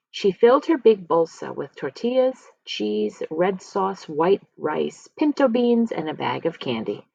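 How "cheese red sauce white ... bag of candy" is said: usual speaking voice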